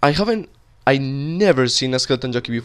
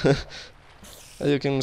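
usual speaking voice

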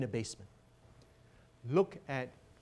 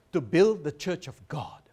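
proud declaration; 4 semitones higher, 11 dB louder than usual. Retold or imitated. Student's bold reply, quoted.

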